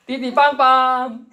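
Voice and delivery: high, slow, drawn-out honking; imitated car horn. Preacher mimicking cars honking at a driver, drawn-out mock horn. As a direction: sing out the honks like a car horn. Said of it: "띠띠빵빵 하는 거예요".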